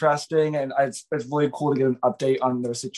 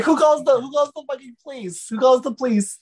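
incredulous; 10 semitones higher, 3 dB louder than usual. Real, not acted. Incredulous rant.

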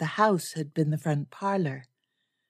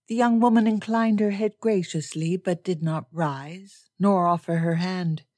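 plain reading voice

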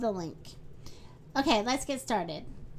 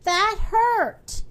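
high-pitched hurt complaint; pained cry; 6 semitones higher, 4 dB louder than usual. A narrator's reading. Mister Tall after the bandage is ripped off, pained cry. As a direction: complain in pain, voice raised high. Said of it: "he cried".

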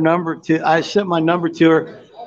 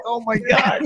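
oh my God through a laugh; giggling exasperation; laughing disbelief; 5 semitones higher, 3 dB quieter, slower than usual. Real, not acted.

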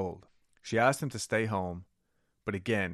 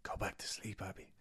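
whispered, much softer; 12 dB quieter than usual. Acted. Husband quietly sending his wife back to sleep, whispered. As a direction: breathe the words to her. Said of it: "he said softly".

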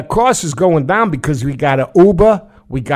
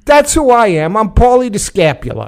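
a hint of a New York wiseguy, louder; mobster Paulie; 6 semitones higher, 4 dB louder than usual. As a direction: play a mock tough-guy mobster. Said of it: "So I said".

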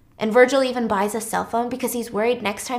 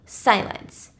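plain reading voice

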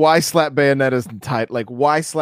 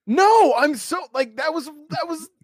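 much higher, gushing; overdone excitement; 12 semitones higher than usual. Shown as a demonstration. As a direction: pile on the enthusiasm. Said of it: "now when I'm sincere, I sound salty".

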